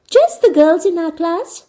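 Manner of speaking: put-on a young girl promising it's only a small party; Anne reassuring. A narrator's reading. Narrator voicing Anne reassuring Marilla, high bright girl voice. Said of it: "Anne assured Marilla".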